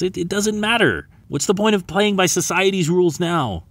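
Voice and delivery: put-on high, insistent girl's voice; young girl's impassioned objection; pleading protest. A narrator's reading. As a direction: argue back, pitched well up. Girl protests being turned down.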